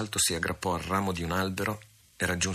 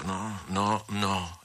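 cornered and desperate; much slower than usual. Acted. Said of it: "esclamò egli con accento disperato".

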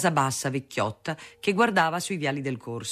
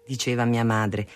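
ordinary delivery, nothing marked

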